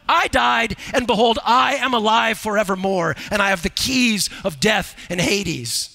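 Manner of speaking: booming sermon reading; preaching at full force. Acted. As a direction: declare it to the whole church. Reads Revelation at full force.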